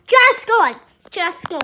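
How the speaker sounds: loud urging at the ball; willing it to go, loud. Real, not acted.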